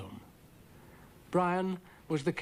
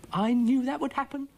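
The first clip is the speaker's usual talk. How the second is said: high, loud grumpy echo; Dougal's voice; 13 semitones higher, 6 dB louder than usual. A narrator's reading. Dougal echoes Florence's remark, high, loud and grumpy. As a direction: echo her crossly, much higher and louder. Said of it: "said Dougal".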